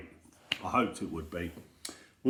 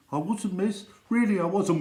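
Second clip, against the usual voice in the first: higher, hurried denial; pleading pupil; 9 semitones higher, 3 dB louder than usual. Acted. Pupil denying to the teacher, high pleading voice. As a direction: protest your innocence like a kid. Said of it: "pupil denies it".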